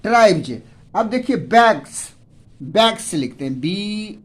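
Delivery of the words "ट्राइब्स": ट्राइब्स ending in a voiced "j"; final j sound. Shown as a demonstration. Teacher shows students how ट्राइब्स is read, final s turned to j. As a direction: say ट्राइब्स as "traib-j", not with an s. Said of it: "यहाँ पर हम लोग पढ़ते हैं".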